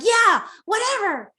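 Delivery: high, dismissive brush-off; sassy kid. Retold or imitated. Eight-year-old brushing off a parent, high and sassy. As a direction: toss it off like a bored, sassy kid. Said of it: "answering you like".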